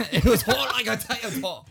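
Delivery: high, loud, laughing English accent. Shown as a demonstration. Topping friend's line, laughing English accent. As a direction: crow it in hammed-up English. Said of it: "English accent".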